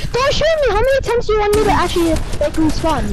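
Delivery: high-pitched, fast-building outburst; exasperated protest. Real, not acted. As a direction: protest shrilly at being shot. Exasperated protest at being shot in game.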